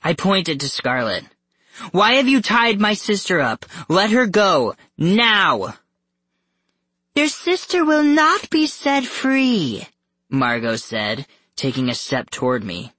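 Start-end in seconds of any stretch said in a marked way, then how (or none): 1.9-5.8 high, loud confrontation; forceful, raised-pitch insistence; angry demand. A narrator's reading. Sibling confronts the captors. 7.1-9.9 very high, slow refusal; high-pitched, drawn-out decree; menacing villainess. A narrator's reading. Villain mother refuses captive's demand.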